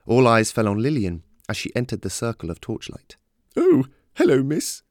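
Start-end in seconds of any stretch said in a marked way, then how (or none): 3.6-4.8 very high, loud woman's greeting. A narrator's reading. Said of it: "said the woman".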